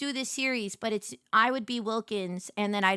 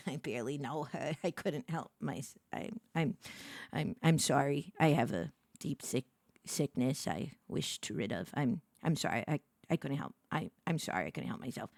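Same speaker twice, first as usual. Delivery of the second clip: hushed, softer stammering apology; sheepish mock confession; 9 semitones lower, 8 dB quieter than usual. Streamer to chat, playing ashamed, soft sheepish mumble. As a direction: mumble it like a guilty secret.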